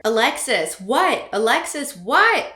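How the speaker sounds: loud back-and-forth calling; call and answer. Acted. Mom calls, Alexis yells back loudly. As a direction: call out the name, shout back what.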